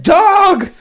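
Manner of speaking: high, loud call to rouse someone; retold urgent shout. Man reenacting rousing his drunk friend, urgent shout. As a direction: shout as if waking a passed-out friend.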